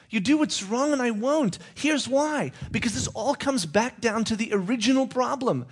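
high, firm lecturing voice; high-voiced God scolding. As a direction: lay down the law high and firm.

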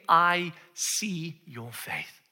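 slow, whispery sincerity; hushed, intimate compassion; tender reassurance. Acted. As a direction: breathe each phrase out gently. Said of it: "He's just saying".

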